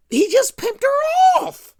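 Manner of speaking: high, loud burst of disbelief; indignant, high-pitched yell; outraged exclamation. Real, not acted. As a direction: cry it out in exasperation. Narrator outraged at Zach's hypocrisy.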